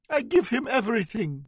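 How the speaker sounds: very high, loud wail of grief. A narrator's reading. Victim wailing to the judge in grief. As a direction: let it break into a wail. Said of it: "she wailed".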